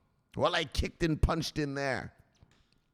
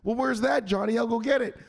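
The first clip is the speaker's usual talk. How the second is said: naive mom; 10 semitones higher than usual. Acted. Mom voiced.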